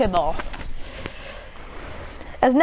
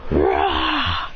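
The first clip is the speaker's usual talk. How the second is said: low monster growl; creature roar. Acted. Lucario roaring at its opponent, a low growl. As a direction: growl it from deep down like a beast. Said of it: "As he goes".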